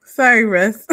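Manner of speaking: apologizing through a laugh. Real, not acted. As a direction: laugh while saying sorry.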